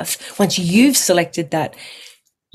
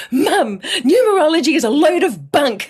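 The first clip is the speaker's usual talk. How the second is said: higher, mock-indignant huff at mum; retold angry outburst; 8 semitones higher than usual. Ringing mum to complain, in a mock huff. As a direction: replay the huffy complaint, half laughing. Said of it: "I remember saying those exact words".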